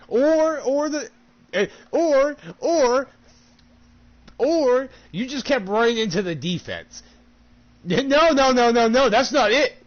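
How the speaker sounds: high, loud, scrambling excuses then cutting them off; frantic excuses. Acted. Gamer mocking his own excuses, high and loud. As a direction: yelp out the excuses and shut them down fast. Mock excuses cut off loudly.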